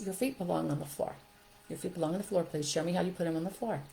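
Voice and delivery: soft, even instruction; calm direction. Shown as a demonstration. Teacher guiding a climbing child to the floor, calm. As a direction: direct the child calmly and simply. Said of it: "Calm, directional, simple".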